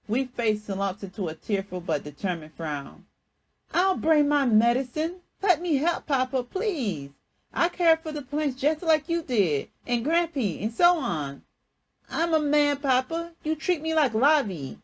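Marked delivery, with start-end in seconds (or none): 3.7-14.8 put-on high, loud eager boy's plea; ten-year-old boy begging to help; child pleading. A narrator's reading. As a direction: beg to come along.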